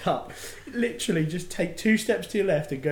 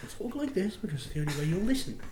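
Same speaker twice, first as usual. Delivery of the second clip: softer, fast, stern hushed warning; stern whispered mum voice; 4 semitones higher, 6 dB quieter, faster than usual. Retold or imitated. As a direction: hiss a warning like a strict mum. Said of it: "the whispered, stern voice that mums do".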